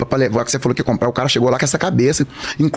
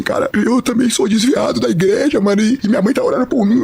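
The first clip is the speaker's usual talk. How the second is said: tearful confession; 6 semitones higher than usual. Retold or imitated. Clerk confessing he also left church, tearful. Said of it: "Aí ele começou a chorar".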